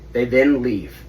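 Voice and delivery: ordinary talk, nothing marked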